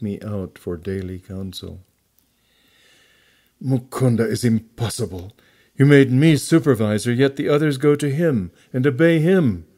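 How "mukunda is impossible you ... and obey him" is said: louder, higher-pitched aggrieved protest; indignant complaint. A narrator's reading. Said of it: "Kumar was complaining to our guru".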